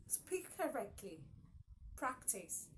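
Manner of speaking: ordinary talk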